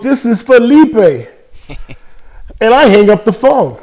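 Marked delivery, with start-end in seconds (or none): 0.0-1.3 put-on Spanish accent. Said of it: "And he goes".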